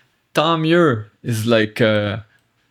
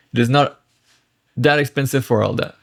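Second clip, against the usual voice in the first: quicker, joined-up repeat of the sentence; natural flow; faster than usual. Shown as a demonstration. Teacher recaps the translation for learners, at natural pace. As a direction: run the translation together at talking speed.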